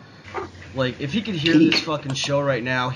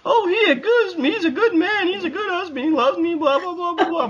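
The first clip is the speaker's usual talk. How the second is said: very high, gushing mock wife voice; squeaky mock devotion, teary on husband; mocking Trump's wife. Wife gushing about her husband, high mocking voice. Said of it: "talking about".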